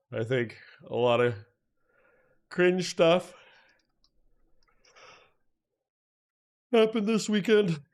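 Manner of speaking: high, put-on squeaky voice for "cringe stuff"; mock high voice before correcting himself; silly high voice. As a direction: pitch it way up like a cartoon joke.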